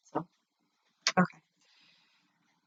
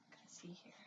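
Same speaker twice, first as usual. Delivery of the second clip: whispering; 29 dB quieter than usual. Real, not acted. Whispering while family sleeps.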